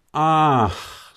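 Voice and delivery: long, sighed "ach"; old man's drawn-out sigh; weary sigh. Acted. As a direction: sigh it out before explaining. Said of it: "seufzt Alter".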